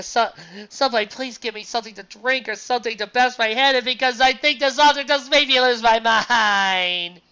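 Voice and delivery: begging for relief, at the end of his rope; losing my mind. Real, not acted. Exasperated despair rant.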